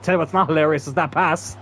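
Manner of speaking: ordinary delivery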